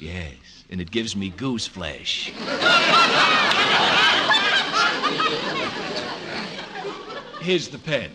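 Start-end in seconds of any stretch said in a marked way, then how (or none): 2.4-7.4 long high shrieking cackle; hysterical cackle. Acted. Clerk bursts into a long high hysterical cackle. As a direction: cackle wildly at the joke.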